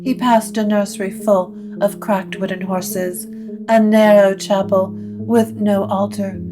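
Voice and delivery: usual speaking voice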